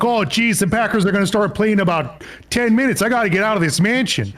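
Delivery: high, sing-song Wisconsin janitor voice; hammy northern Midwest accent. Pretend. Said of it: "I like your Canadian accent".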